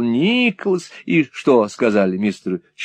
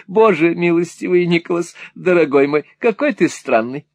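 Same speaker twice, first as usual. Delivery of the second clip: lightly dramatised Mrs Nickleby; exclaiming, wistful mother. Acted. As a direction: start exclaiming, ease into fond lament.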